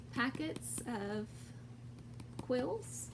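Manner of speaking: usual speaking voice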